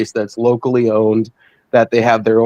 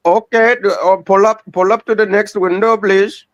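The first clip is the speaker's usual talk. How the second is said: high-pitched drive-thru cashier voice; fast food cashier; 8 semitones higher than usual. Retold or imitated. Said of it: "Good impression".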